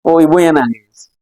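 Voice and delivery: ordinary talk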